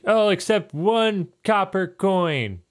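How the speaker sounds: high and slow, haggling; grudging old man. Acted. Old neighbor names his price, slow high. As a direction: state the price slowly.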